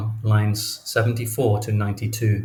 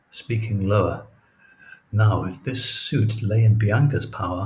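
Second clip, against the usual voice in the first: ordinary talk, nothing marked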